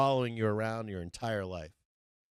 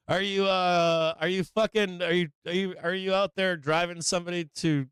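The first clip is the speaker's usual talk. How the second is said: higher, raised-voice grilling; ranting interrogation; 8 semitones higher, 5 dB louder than usual. Acted. Streamer grilling internet complainers, ranting interrogation. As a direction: fire off loud rhetorical questions. Mock interrogation of online critics.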